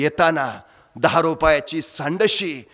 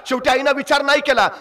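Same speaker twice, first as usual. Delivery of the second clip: emotive Marathi storytelling, rising with the drama; story narration; 8 semitones higher than usual. A narrator's reading. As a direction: tell it like a village storyteller moving the crowd. Storyteller narrating village tale.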